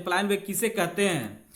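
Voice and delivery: normal talking voice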